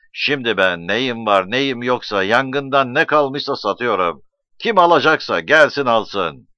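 loud, projected farewell speech; shouted address. A narrator's reading. Hasan Ağa announcing his departure and sale to villagers, shouted address. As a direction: announce it forcefully to the whole crowd. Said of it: "diye bağırdı".

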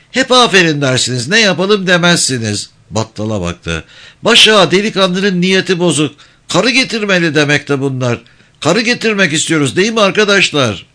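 high, sly village elder; holding court with mock gravity; sly old man speech. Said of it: "kaşlarını çattı". (tiz sesli, sinsi yaşlı ağa konuşması)

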